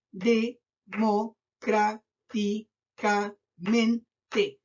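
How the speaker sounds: democráticamente in seven slow, separate beats; syllable by syllable. Shown as a demonstration. Teacher counting seven syllables for pupils, very slow, syllable by syllable. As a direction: chop it up with a pause after every syllable. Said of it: "siete sílabas".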